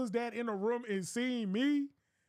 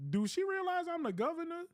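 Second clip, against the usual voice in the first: indignant governor; 8 semitones higher than usual. Retold or imitated. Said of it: "he was like".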